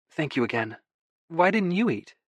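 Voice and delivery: lightly voiced wife. A narrator's reading. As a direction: play the wife with a lifted voice. Narrator voices Freya.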